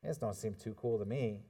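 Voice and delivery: low, quiet deflated dismissal; acted-out letdown, soft and low; unimpressed reader. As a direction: lose all interest, dropping the voice. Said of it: "and we're like".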